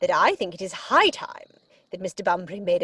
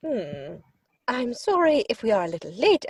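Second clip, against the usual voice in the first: usual speaking voice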